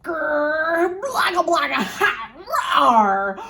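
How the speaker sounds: loud, high monster growl and roar. Acted. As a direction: give it all you've got, monster. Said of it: "the monster gave it all he had".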